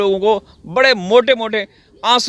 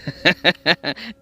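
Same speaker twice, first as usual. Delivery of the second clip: soft chuckle; 3 semitones lower, 3 dB quieter than usual. Real, not acted. Laughs at own slip.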